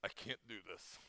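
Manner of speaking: low, hushed admission of defeat; soft, drained plea after a long silence; overwhelmed plea. Retold or imitated. A pastor praying to God at the breaking point, overwhelmed and hushed.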